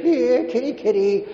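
high singsong call; calling the cat. Retold or imitated. Owner calling the family cat in a high singsong coax. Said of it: "You'd say".

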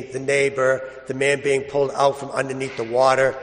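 ordinary delivery

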